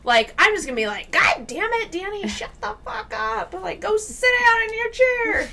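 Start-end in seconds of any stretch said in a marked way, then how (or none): 1.1-5.5 high-pitched mock scolding; cranky old lady. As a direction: play a fed-up old woman ordering someone about.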